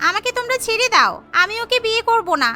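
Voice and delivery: put-on old woman caught and beaten, pleading with the family; pleading rich old woman. Girlfriend beaten, begs.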